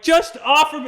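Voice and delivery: mock plea to the interviewers; pleading outburst. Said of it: "I'm like".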